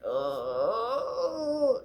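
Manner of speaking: long, high mock whimper; drawn-out nervous high moan; timid whimper. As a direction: imitate a timid guy whining wordlessly. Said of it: "the timid type of guy that's like".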